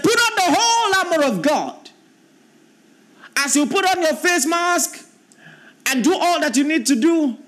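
preacher calling the church to arm itself; preaching at full force. Acted. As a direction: proclaim it like a rallying cry. Preaching scripture, high.

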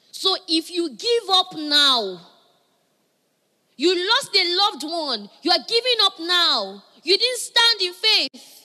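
loud, slow, emphatic exhortation; full-force sermon; forceful preaching. Real, not acted. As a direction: hammer each phrase to the congregation.

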